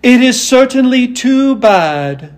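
high, slow Tin Woodman sympathy; tender-hearted Woodman. A narrator's reading. Woodman commiserating with Dorothy, high and slow. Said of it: "said the tender-hearted Woodman".

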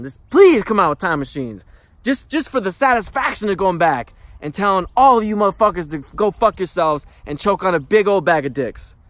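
loud, high-pitched tirade; furious rant. Real, not acted. Telling old classmates off, he shouts in fury. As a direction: let it rip at the people who mocked you.

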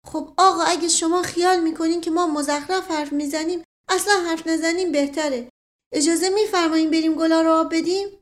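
simple servant in a huff; offended servant. Acted. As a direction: take offence and ask to be excused. Mashghasem, offended.

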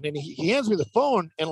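plain reading voice

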